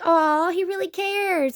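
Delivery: mocking syrupy sympathy; mock gushing. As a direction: imitate friends fawning over him, drawn out. Mocking friends' gushing reply.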